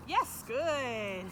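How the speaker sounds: ordinary talk, nothing marked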